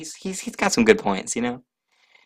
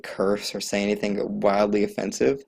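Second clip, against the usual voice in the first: ordinary talk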